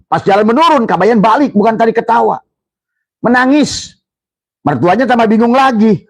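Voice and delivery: father-in-law's baffled high question at the end; animated storytelling. Real, not acted. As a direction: build the surprise of the story. Lively storytelling climax.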